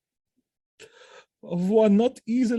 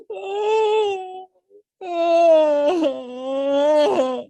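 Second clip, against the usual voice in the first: much higher, breaking sobs; wailing sob; 8 semitones higher, much slower than usual. Acted. Othello sobbing over Desdemona's body. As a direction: wail her name in despair. Othello wails for dead wife.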